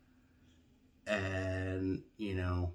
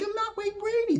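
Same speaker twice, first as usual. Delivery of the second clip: much higher, exasperated squeal; high insistent denial; 4 dB louder than usual. Retold or imitated. Correcting the DMV staff, high exasperated squeal.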